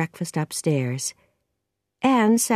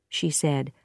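plain reading voice

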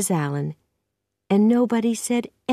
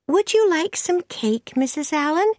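put-on high-pitched girl's voice; eager young Anne; 10 semitones higher than usual. A narrator's reading. Anne offers the minister's wife cake, high and eager. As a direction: offer it politely in a high girlish voice.

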